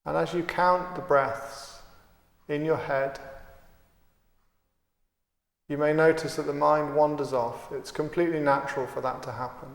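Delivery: calm, unhurried meditation guide with long pauses; guided meditation. Acted. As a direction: count and guide the breath steadily, leaving space between phrases. Leads a guided breathing meditation.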